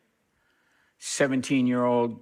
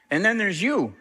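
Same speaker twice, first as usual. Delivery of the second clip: animated; 8 semitones higher, 3 dB louder than usual. Retold or imitated. [name] repeats the line, pitched up.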